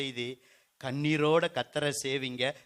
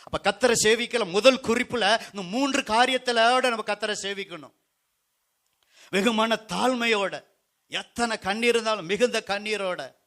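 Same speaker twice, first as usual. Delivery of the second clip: raised, emphatic pulpit delivery; forceful preaching; 6 semitones higher, 7 dB louder than usual. Real, not acted. Preacher sums up serving with humility and tears, forceful preaching. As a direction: press the points home to the congregation.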